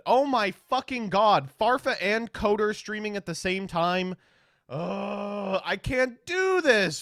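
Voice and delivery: high, loud, drawn-out despair; exasperated wail. Real, not acted. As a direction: wail and groan at each new piece of bad news. More rivals found streaming.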